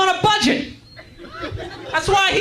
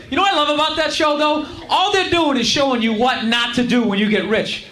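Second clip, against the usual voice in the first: ordinary delivery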